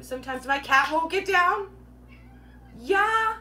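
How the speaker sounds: high, loud sudden outburst; reacting to the cat that won't get down, high and loud; startled call-out. Real, not acted. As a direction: break off at the cat. Cat interrupts.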